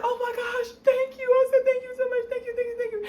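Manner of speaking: high, gushing, breathless gratitude; gushing thanks. Retold or imitated. Retelling her thanks to the lawyer, gushing. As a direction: thank him over and over, overjoyed.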